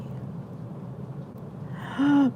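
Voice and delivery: gasp. Real, not acted. Reacting to a food in chat.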